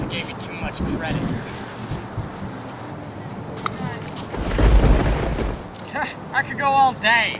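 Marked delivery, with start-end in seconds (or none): 1.2-6.3 long loud breathy taunting laugh. Acted. Ghost taunting kids after dodging their hits, long loud laugh. 6.3-7.4 gloating taunt. Acted. Ghost taunting kids.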